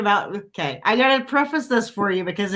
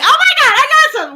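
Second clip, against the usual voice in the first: much higher and louder, thrilled; excited squeal; 7 dB louder than usual. Retold or imitated. On the boat, hooking a fish, shrieking with excitement. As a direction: squeal it like you've just hooked your first fish. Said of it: "And I was like".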